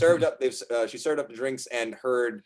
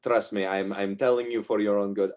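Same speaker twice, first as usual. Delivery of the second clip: ordinary delivery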